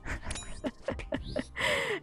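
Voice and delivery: long high giggle, mostly breath; helpless real giggle at the memory; breathy laughter. Real, not acted. Laughing at friend's retold exit.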